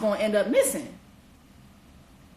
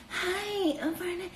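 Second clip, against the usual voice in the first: higher, much softer sweet hello; put-on flirty voice; 7 semitones higher, 7 dB quieter, slower than usual. Jessica greeting Barnett in a higher, softer put-on voice. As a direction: coo the greeting high and soft. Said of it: "She would put on this fucking voice".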